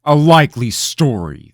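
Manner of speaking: loud, high scornful growl; king scorning the boy's story, growling. A narrator's reading. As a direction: sneer at his excuse. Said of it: "the king growled".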